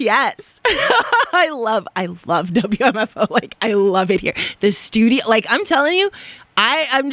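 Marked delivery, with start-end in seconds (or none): 0.6-1.3 short high giggle; brief burst of high laughter; happy laugh. Real, not acted. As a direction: giggle with delight. Laughs on air.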